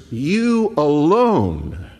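"you alone" said very slowly, YOU punched; emphatic you alone. Shown as a demonstration. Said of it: "it's emphatic".

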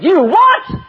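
sharp, high, loud "You what?"; retold announcer's indignant yelp; incredulous outburst. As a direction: blurt it in shocked disbelief. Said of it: "Like that, on live radio".

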